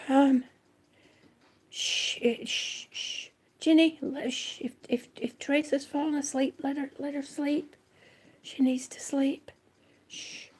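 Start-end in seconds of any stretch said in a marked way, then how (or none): 1.7-10.5 soft whisper so nobody wakes; shh, let her sleep, barely voiced and much softer; whispered hush. Real, not acted. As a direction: hush them, someone is asleep. Hushing so sleeper not woken.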